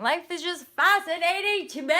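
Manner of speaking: bright, loud and high with wonder; excited. Real, not acted. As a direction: gush about how fascinating life is. Enthusing about life.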